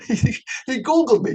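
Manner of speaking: amused disbelief through a laugh. Real, not acted. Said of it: "I'm getting all fired up".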